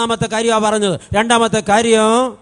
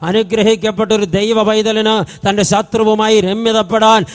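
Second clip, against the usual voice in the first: building pulpit delivery, ending louder; preaching; 2 semitones higher, 6 dB louder than usual. Acted. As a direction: preach it to the congregation.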